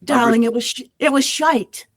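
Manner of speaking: playful, theatrical English accent. Shown as a demonstration. Performing on the host's request, in an English accent. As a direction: put on English for the swear word. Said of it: "do the bullshit line in the English accent".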